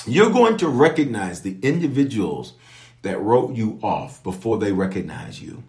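normal talking voice

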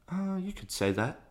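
high, sheepish little reply; coy understatement. Retold or imitated. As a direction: squeak out a modest answer. Retold understated reply to coach.

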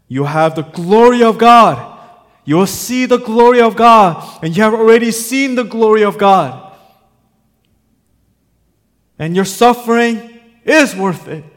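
loud, high, impassioned sermon cry; preacher rising to a high, forceful peak; full-force preaching. Real, not acted. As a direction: proclaim it loudly to the whole congregation.

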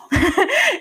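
high, loud laugh; sudden rueful laugh; bursting laugh. Real, not acted. Laughing at her own plan.

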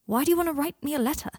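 quick, high, puzzled question; baffled. A narrator's reading. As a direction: ask in genuine bewilderment.

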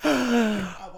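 ordinary talk, nothing marked

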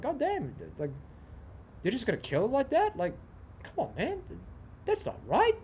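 high, incredulous protest at the screen; can't believe what he's watching; high-pitched outraged disbelief; shocked disbelief. Retold or imitated. Reacting to the film's scene.

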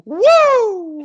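excited commentator cry after a dunk; Kevin Harlan woo. Retold or imitated. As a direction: let out a hyped play-by-play woo. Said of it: "and then he goes".

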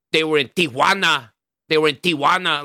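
lightly mocking Spanish accent on "Tijuana", said "tiwana". Mocking Americans who fake a Spanish accent, light Spanish accent. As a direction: parody a gringo rolling "Tijuana" like a Spanish speaker. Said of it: "people who will try to say".

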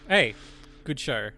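ordinary talk

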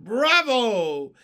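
loud, high cries of acclaim; an enthusiastic audience shouting out; shouted cheer. A narrator's reading. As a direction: cheer loudly for an encore. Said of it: "stood up and shouted".

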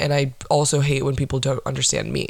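ordinary talk, nothing marked

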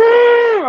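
sudden high yelled "Ah!" to show no fear; mock roar at tigers. To the tigers in the cage, a sudden loud high yell. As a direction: bark out a sharp high "Ah!" to prove he's unafraid. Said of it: "I went like that".

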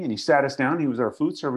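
ordinary delivery

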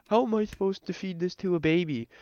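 high, mock outraged customer voice; indignant customer. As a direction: whine it like the upset customer. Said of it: "she's like".

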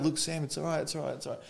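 imitating a soothing mother; lightly acted motherly reassurance; reassuring mum. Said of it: "She's like".

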